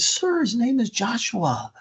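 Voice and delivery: high, emotional voice, lightly acting tears; high-pitched and overcome, without real sobs; tearful, retold. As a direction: imitate a woman crying with relief. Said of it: "she's crying".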